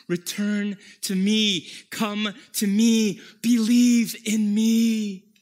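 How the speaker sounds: loud, slow, pleading call; Jesus calling out. Acted. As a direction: call the lost back with full voice. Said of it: "Jesus is telling you".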